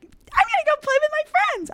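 put-on sing-song like a happy kid; suddenly cheerful. After leaving the room, fine again, high childlike cheer.

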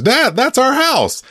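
put-on very high, drawn-out kid voice; protesting Lisa. Lisa calling out to Homer pelting the house, dismayed. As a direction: imitate a dismayed daughter, slow and high.

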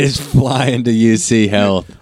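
words broken by real laughter; giggling through the punchline; laughing. Real, not acted. Cohost laughing through a joke about the helicopter, laughing.

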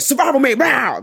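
high, loud mock battle cry; imitated outburst. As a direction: burst out loudly, mimicking him. Imitating his immediate hyped outburst.

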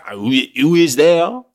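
high, loud cartoon French accent; over-the-top comic French accent; exaggerated French accent. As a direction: call out in a hammed-up French accent, rising. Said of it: "give him a French accent".

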